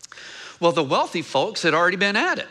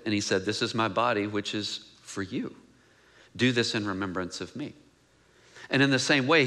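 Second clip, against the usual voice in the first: lower, softer solemn reading of the passage; scripture reading; 4 semitones lower, 3 dB quieter than usual. Acted. Reads the scripture passage aloud.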